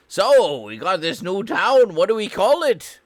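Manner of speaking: loud, high hammed-up Scottish settler; mock Scottish settler. Voicing settlers naming a town.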